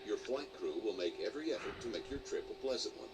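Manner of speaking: normal talking voice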